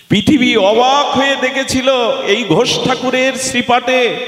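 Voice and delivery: very high, sustained proclamation; declamatory sermon cry; impassioned preaching. Acted. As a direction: announce the marvel high and ringing. Preaches at full force.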